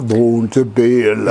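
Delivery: low, slow and loud bass voice; deep voice. Shown as a demonstration. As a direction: drop the voice down deep. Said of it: "Tu peux me faire la voix grave".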